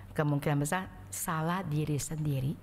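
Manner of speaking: ordinary talk, nothing marked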